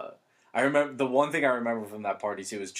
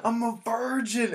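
high, loud, drawn-out mock scream; imitated scream; 5 dB louder, slower than usual. Naked friend screaming at a pool party, loud mock scream. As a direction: belt it out slowly like a proud announcement. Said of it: "he was just screaming".

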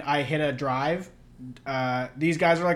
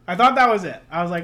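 ordinary delivery

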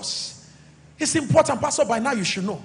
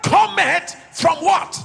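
loud, raised, emphatic sermon delivery; full-force preaching; 6 semitones higher, 4 dB louder than usual. Real, not acted. Pastor urging the church to trust God as source, preaching forcefully. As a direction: drive each phrase home to the congregation.

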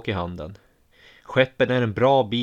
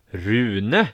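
calling from afar; 4 dB louder than usual. A narrator's reading. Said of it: "ropas det från skogen".

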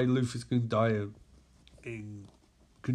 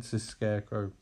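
usual speaking voice

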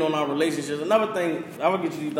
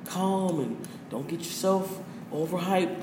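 much softer, slowed mock calm; 8 dB quieter than usual. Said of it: "society teaches what?".

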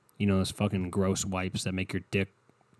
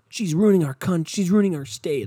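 higher, louder mock outrage at the doctor; mock outraged supporter; 7 semitones higher, 6 dB louder than usual. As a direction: ham up the indignant ranting. Mocking angry Trump supporters.

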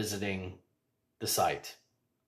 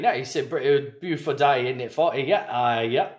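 mock British accent; 3 semitones higher, 5 dB louder than usual. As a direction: improvise a cartoonish British chat. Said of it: "that was a good British accent impersonation".

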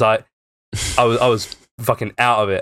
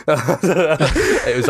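laughing; 5 semitones higher than usual. Real, not acted. Laughing at own story.

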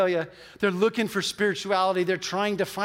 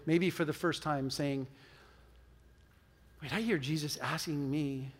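lower, subdued praying voice; spoken prayer; 4 semitones lower, 5 dB quieter than usual. Real, not acted. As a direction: pray quietly and evenly for the congregation. Leads the congregation in prayer.

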